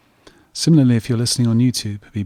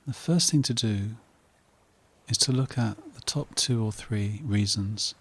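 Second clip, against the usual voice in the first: soft, unhurried meditation voice with long pauses; guided meditation; 5 dB quieter than usual. Real, not acted. Host guiding listeners to relax, calm meditation voice. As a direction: settle the listener into calm, phrase by phrase.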